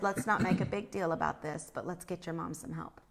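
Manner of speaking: low, hushed retold aside; discreet reassurance. Retelling a quiet word to the woman's family, discreetly reassuring. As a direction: drop it low and soft to calm things down.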